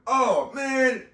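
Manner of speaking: high, loud "oh man" in dismay; flustered groan. Retold or imitated. Young man on the court panicking over the interview. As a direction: yelp of panic at the news. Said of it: "I'm going".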